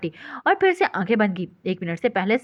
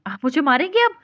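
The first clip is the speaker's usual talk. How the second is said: higher and louder, frightened; frightened question; 5 semitones higher, 5 dB louder than usual. A narrator's reading. Wife asks if husband will hit her, frightened. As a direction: ask it fearing the blow. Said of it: "Priam ने डरते हुए बोली".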